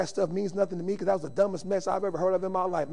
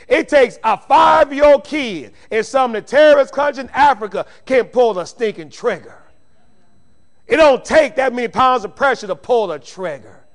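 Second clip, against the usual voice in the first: full-force preaching; 5 semitones higher, 13 dB louder than usual. Real, not acted. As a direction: preach it at full volume, driving every phrase home.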